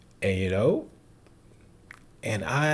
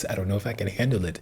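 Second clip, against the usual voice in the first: repeated fast, a bit higher, overwhelmed; 4 semitones higher, much faster than usual. Shown as a demonstration. Reviewer admitting the film is too much, fast and overwhelmed. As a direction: rush it out as if it's too much.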